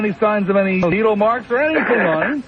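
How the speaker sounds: squeaky comic persona needling a guest; falsetto comic character. Acted. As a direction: tease the caller in a silly high voice. Host's put-on character voice.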